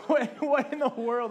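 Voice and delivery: loud, high burst of disbelief through a laugh; astonished outburst. Retold or imitated. Worshipper overwhelmed by God's endless breath, astonished laughing outburst. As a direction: blurt it out, amazed and laughing.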